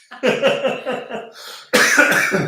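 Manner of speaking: high breathy burst of laughter; real laughter bubbling out. Real, not acted. Laughing at own pronunciation.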